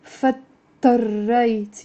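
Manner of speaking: فاضطريت said slowly, the d dropped into a doubled t; slow classroom demo; slow model pronunciation. Shown as a demonstration. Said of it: "Say with me".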